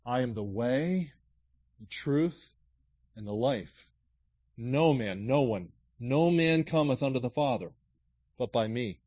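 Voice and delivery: slow, deliberate verse reading; slow scripture reading. Acted. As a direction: savour each phrase of the verse. Rereads verse slowly.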